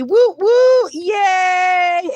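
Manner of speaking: loud, high whoop of joy; excited cheering. Real, not acted. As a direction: whoop and cheer for the new couple. Cheering the chat love match.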